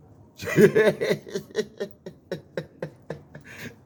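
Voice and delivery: a burst of high, loud laughter. Real, not acted. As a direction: laugh out hard at your own point. Laughs at own point.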